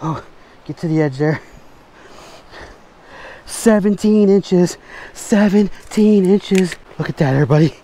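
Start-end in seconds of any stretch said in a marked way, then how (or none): 3.4-6.8 excited announcement. Real, not acted. Announcing measured big fish.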